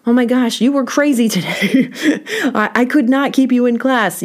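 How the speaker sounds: a hint of an exasperated teacher; stern teacher. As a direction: retell a teacher scolding a kid. Teacher scolding student, retold.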